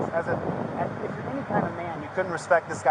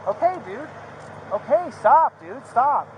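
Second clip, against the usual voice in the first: pleading shout; 4 semitones higher, slower than usual. Real, not acted. Begging officer to stop.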